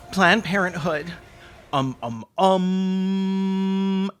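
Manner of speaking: usual speaking voice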